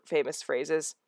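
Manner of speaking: ordinary delivery, nothing marked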